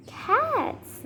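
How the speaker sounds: high, swooping "Cats" in disbelief; incredulous. Acted. Learning the animal man keeps cats, incredulous. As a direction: send "Cats" way up, amazed.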